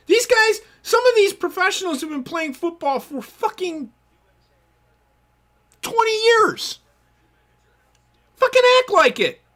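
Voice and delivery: exasperated, demanding they grow up; real frustration at grown pros; heated rant. Real, not acted. Heated rant about pro players.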